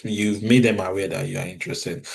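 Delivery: ordinary delivery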